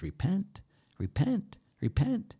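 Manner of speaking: high rising call on each word; separate, lifted calls, each word rising; calling to repent. Retold or imitated. Priest quotes Jesus' first words, high rising call.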